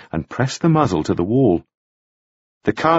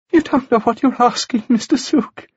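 anxious woman voice; 14 semitones higher than usual. Acted. Margaret's voice continues.

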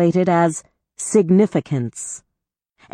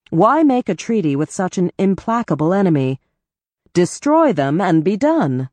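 ordinary delivery, nothing marked

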